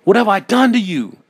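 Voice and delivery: loud, high-pitched desperate yell. A narrator's reading. Narrator begging the mirror eyes to leave, yelling. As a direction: plead at full yell. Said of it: "I yelled".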